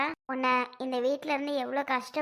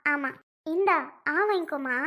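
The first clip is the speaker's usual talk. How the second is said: ordinary talk, nothing marked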